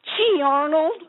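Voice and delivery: G Arnold said high in a drawled Southern character voice; old school Southern voice. Shown as a demonstration. Guest voices his Southern character for the host, high Southern voice. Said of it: "Can you do G Arnold in your old school, uh, Southern voice?".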